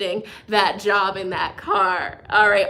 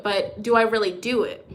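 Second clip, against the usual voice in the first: ordinary talk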